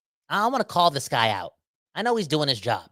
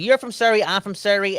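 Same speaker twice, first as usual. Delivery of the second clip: excited; 6 semitones higher, 5 dB louder than usual. Real, not acted. Excited banter about teammate.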